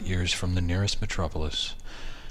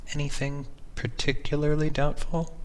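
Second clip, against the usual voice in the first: higher, gently probing wife; 9 semitones higher than usual. Acted. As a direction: lift it into a curious wife's voice. Wife voiced in a story.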